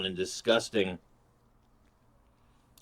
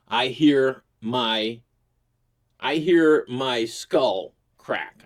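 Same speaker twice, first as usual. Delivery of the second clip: dramatic slowdown; 4 dB louder, much slower than usual. Retold or imitated. Dramatic retelling of the fall.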